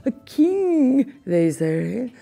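feigned shock. A narrator's reading. Villain lying to the king, feigned shock. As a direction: fake outrage to fool the king. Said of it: "pretending to be greatly shocked".

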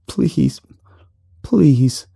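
high, pleading whimper. A narrator's reading. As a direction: whimper each word as a plea. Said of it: "the man whimpered".

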